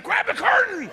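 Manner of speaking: high, loud panicked order; urgent yell. Acted. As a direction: shout it like a panicking voice in your head. Inner voice yells.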